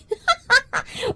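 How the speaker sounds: long high giggle; bashful giggling. Real, not acted. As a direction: laugh it off, embarrassed and pleased. Flattered by compliment.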